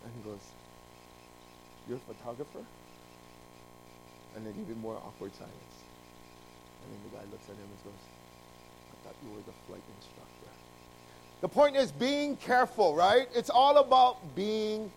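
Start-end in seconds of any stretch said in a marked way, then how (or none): 1.9-2.6 deadpan question. Acted. Pilot's awkward deadpan question. 8.9-10.5 much softer and lower, quick, flat delivery; low, quiet, matter-of-fact pilot; deadpan punchline. Acted.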